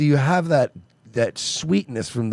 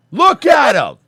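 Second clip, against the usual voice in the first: mock outrage; 9 semitones higher, 6 dB louder, slower than usual. Mock distress.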